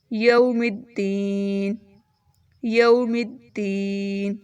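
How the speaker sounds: slow یوم الدین with clear final n; slow recitation. Shown as a demonstration. Teacher showing a clear stop. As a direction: end crisply on the ن. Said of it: "آخری حرف کو صاف ادا کیجیے".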